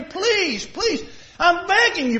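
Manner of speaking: heartfelt imagined plea; pleading. Acted. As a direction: beg him not to fall. God pleading with Adam.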